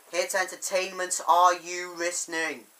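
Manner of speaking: loud, pointed call-out. Real, not acted. Reviewer addressing Hit Entertainment, loud call for attention. Calling out to the company.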